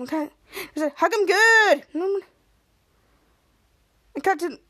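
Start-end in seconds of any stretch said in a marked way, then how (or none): 1.0-1.9 louder, higher mock command; emphatic order. Captain Man wanting a harder hug, emphatic command. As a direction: punch out the order.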